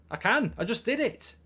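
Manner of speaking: normal talking voice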